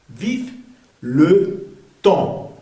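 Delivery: "Vive le temps" with long gaps between words; word-by-word model of "Vive le temps"; silent P and S. Shown as a demonstration. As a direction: end "temps" on a nasal vowel, no P or S. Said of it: "No se pronuncia la P".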